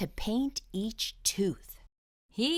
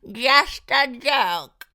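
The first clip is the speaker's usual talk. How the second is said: slow, sly fox voice, louder; chortling fox; 5 semitones higher, 9 dB louder, much slower than usual. Acted. Fox teasing the dentist, slow sly voice. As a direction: drag it out with a chuckle. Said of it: "he chortled".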